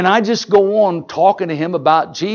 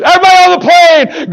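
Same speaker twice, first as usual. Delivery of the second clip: high, loud, fast shout to the crowd; rally everyone to join in singing; calling out; 11 semitones higher, 8 dB louder, faster than usual. Retold or imitated. Calls passengers to join the singing.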